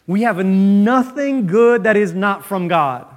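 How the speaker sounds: ordinary talk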